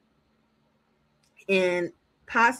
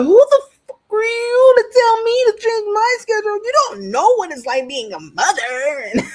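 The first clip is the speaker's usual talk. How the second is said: much higher and louder, outraged yelling; furious shout; 11 semitones higher, 8 dB louder than usual. Retold or imitated. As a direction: scream at her like she insulted you. Mothers furious at Angelina, retold.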